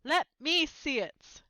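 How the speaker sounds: loud, slow demanding. A narrator's reading. Dudley demanding to see the letter, loud and slow. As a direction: drag out the demand. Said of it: "demanded Dudley".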